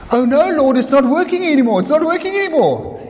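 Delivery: high, rushing panic; panicked Peter. Acted. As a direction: cry out as you start to sink.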